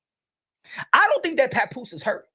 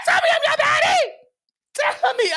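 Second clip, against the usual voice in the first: much higher, louder, fast shout; mock demand; 12 semitones higher, 3 dB louder than usual. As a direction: yell it like a jealous demand.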